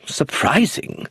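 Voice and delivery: slow, rising incredulous echo; exclaiming. A narrator's reading. The lawyer echoing the father's news, incredulous. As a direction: echo the word in disbelief. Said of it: "exclaimed Mister Gateskill".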